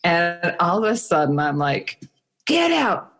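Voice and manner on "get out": high-pitched mock yell; imitated screech, pitched way up; retold scream. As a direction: re-enact screaming everyone out of the room. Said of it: "screaming at people to get out".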